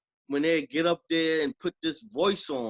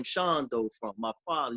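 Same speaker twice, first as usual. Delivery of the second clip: usual speaking voice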